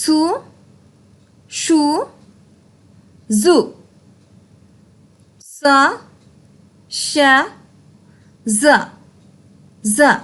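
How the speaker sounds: সু hissed, শু hushed, জু buzzed, each clearly apart; sound drill. Shown as a demonstration. Teacher repeating the three sounds for the class. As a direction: contrast s, sh and z one by one. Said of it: "সাউন্ডগুলো আবার একটু খেয়াল করি".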